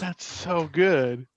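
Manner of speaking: slow, relishing praise; drawn-out delight. Real, not acted. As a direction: savour every word. Repeated reaction, stretched.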